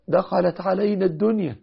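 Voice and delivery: rising anguish over the money; distressed cry. Retold or imitated. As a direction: Talha crying out that the world has come in. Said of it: "قال".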